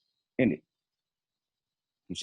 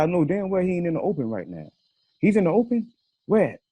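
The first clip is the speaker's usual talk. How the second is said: high, heated disbelief at Jay being exposed; exasperated outrage, pitched high; angry retold rant; 6 semitones higher than usual. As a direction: fire off the questions in rising anger. Pissed, retelling angry call.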